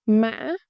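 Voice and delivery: "Mã" snapped in the middle, then up; broken rising tone. Shown as a demonstration. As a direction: catch "Mã" mid-vowel and rise. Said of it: "is broken raising".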